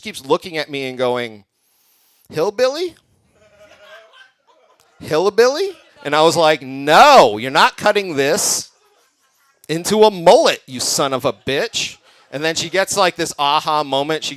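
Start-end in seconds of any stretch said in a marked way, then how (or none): 2.3-5.7 high, puzzled questioning voice of the hairdresser. Retold or imitated. Haircutter's questioning broken English. 6.6-12.0 louder, slow, indignant refusal; mock protest. Refusing a mullet, retold outburst.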